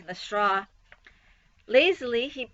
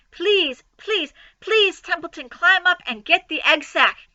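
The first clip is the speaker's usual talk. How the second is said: high, loud pleading; desperate begging; 7 semitones higher, 6 dB louder than usual. Acted. Wilbur begging Templeton for the egg sack, high and loud. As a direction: beg with rising urgency.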